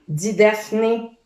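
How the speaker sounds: ordinary delivery, nothing marked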